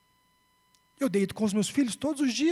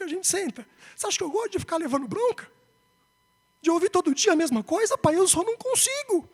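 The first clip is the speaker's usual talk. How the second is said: imitating an upset child, pitched high; pleading son; 8 semitones higher than usual. Son confessing to his father that he can't change, pleading. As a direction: plead like a frustrated boy, voice climbing at the end.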